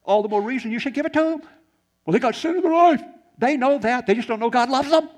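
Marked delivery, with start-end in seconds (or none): 2.1-3.0 high, loud protest; imitating an objector at full volume; loud mock objection. Acting out a congregant's objection. 3.4-5.1 high, loud preaching; raised voice, driving the point home; emphatic rebuttal. Real, not acted. Preacher forcefully answers the objection.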